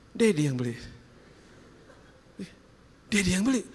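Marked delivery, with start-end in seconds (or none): 3.1-3.6 high, rising question; surprised child's question. Acted. Child learns Daddy was the buyer, surprised. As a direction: echo it back higher, as a child would.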